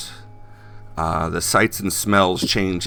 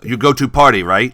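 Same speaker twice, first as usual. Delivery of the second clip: put-on loud, higher voice with a Chinese accent; Chinese-accented taxi driver, pitched up; Han; 6 semitones higher, 10 dB louder than usual. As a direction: ask eagerly in choppy Chinese-accented English. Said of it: "accented with Chinese".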